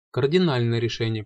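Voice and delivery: usual speaking voice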